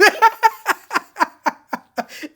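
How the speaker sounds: high, loud delighted laugh; squealing giggle fit; burst of laughter. Real, not acted. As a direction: break into a big laugh. Laughing at the awkward line.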